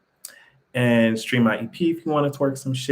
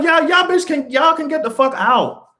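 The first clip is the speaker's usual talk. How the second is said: much higher, louder pretend yell; kicking fake-crying relatives out, shouting high; mock shout; 13 semitones higher, 3 dB louder than usual. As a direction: throw them out at the top of your voice. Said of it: "I'll be like".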